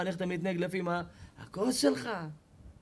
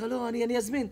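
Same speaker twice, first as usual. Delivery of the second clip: apologetic waitress; 8 semitones higher, 4 dB louder than usual. Acted.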